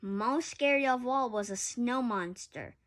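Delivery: ordinary delivery, nothing marked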